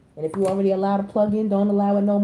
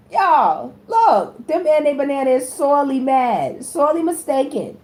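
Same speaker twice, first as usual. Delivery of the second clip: loud, high, amused exclaiming; worked-up, high-pitched announcement; excited outburst; 4 semitones higher, 5 dB louder, slower than usual. Real, not acted. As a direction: call it out to everyone, loud and high. Excited reaction after laughing at message.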